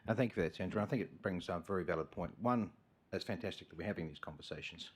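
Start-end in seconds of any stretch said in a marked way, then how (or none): none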